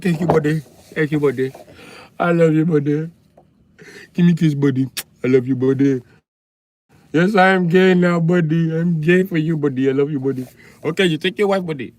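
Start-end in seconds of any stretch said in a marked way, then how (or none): 0.0-10.5 put-on Indian accent. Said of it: "you be like".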